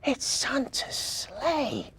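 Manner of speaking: high, slow, drawn-out wonder; excited little boy. A narrator's reading. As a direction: marvel at it in a child's voice. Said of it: "with excitement".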